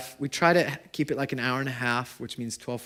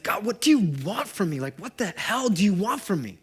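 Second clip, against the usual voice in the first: retold yell at God; 7 semitones higher than usual. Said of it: "I began to yell at God".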